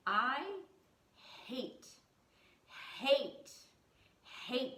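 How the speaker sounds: "hate" repeated alone with long pauses; "I" and "hate" stressed one by one, slowly; emphatic loathing. Real, not acted. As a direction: punch each "hate" as its own sentence. Emphatic loathing of phone calls.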